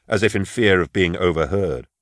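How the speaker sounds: ordinary delivery, nothing marked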